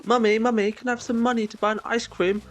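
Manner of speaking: put-on high, whiny little kid begging mum; pleading child. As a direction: beg like a small child asking for money. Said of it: "Do you have to turn around and go".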